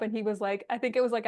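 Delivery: usual speaking voice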